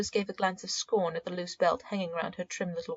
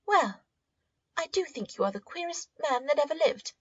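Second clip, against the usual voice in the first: put-on higher, indignant young girl; girl's voice; 8 semitones higher than usual. A narrator's reading. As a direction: play Rose, shocked and huffy.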